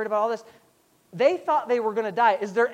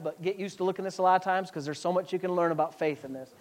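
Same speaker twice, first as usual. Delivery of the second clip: ordinary talk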